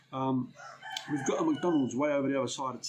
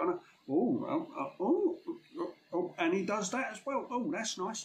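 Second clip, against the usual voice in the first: higher-pitched mock oohs of approval; impressed surprise; 6 semitones higher, slower than usual. Client leafing through the hand-delivered quote, mock impressed. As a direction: react as a pleasantly impressed client, voice lifting.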